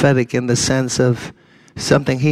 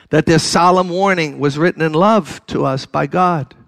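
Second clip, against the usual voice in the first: ordinary delivery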